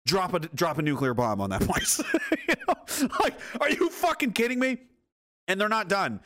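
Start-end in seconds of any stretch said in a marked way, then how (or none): 0.1-4.8 incredulous laughing. Real, not acted. Reacting to a humiliating picture, incredulous laughter. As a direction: laugh through the outrage, pitch climbing high. Incredulous outburst about the photo.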